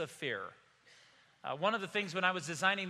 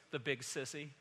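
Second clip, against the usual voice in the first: lower, softer imitation of a scared child; mock shaking voice; 4 semitones lower, 7 dB quieter than usual. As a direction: mutter it like a shaken little boy. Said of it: "saying in a shaking voice".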